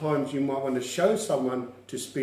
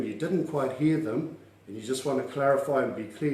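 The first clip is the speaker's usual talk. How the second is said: ordinary talk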